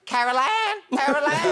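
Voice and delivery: creepy film call. Retold or imitated. Mimicking a spooky horror-film call to a fan of horror films. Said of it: "I like the ones".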